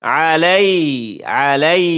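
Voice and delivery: আলাই with the ع pressed from mid-throat; teacher's demo. Shown as a demonstration. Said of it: "ইয়ার মধ্যে চাপ দিয়া ধরবা".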